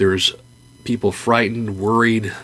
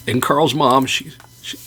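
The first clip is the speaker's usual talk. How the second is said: usual speaking voice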